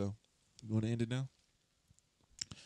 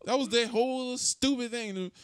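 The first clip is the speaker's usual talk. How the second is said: annoyed; 12 semitones higher, 7 dB louder, slower than usual. Real, not acted. Annoyed complaint, high and slow.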